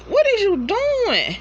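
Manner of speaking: high, incredulous question; baffled man. Retold or imitated. Homeboy asking the lady what she's doing, high and baffled. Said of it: "homeboy like".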